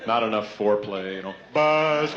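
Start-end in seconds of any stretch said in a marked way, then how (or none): 1.5-2.1 loud buzz imitation; buzzer noise. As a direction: blurt out a buzzer sound.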